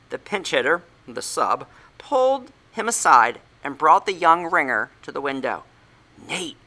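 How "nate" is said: whispered. A narrator's reading. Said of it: "I whispered".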